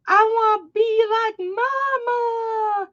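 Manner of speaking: put-on high, slow little-kid voice; toddler voice. Mom imitating her toddler son explaining himself, childlike. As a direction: sound like a tiny boy copying mom. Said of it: "He's like".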